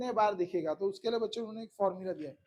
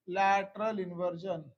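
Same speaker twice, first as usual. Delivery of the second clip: slow, drawn-out key term; deliberate teacher's pace on the term; slow dictation; 2 semitones lower, much slower than usual. Real, not acted. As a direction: say it slowly for students to catch.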